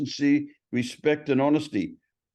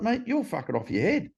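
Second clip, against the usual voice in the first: imitated sponsor's high-pitched telling-off; exasperated reproach; 9 semitones higher than usual. Sponsor telling his mate he's crazy, exasperated.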